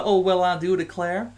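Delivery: camp up a genteel Southern lady; Southern Belle. Shown as a demonstration. British player trying a Southern Belle accent. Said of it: "it's a little bit pushing towards the".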